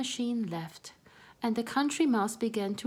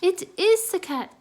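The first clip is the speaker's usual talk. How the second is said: much higher, much louder alarm; loud high panicked warning; crying out; 10 semitones higher, 10 dB louder than usual. Acted. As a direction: cry out a warning. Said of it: "cried Melissa".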